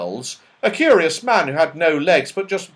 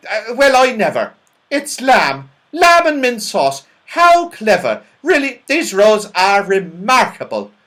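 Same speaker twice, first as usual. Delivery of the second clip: put-on high, loud, amazed old pedlar; Saucepan's voice; 8 semitones higher, 8 dB louder than usual. A narrator's reading. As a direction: marvel out loud like a deaf old man. Saucepan voiced in read story.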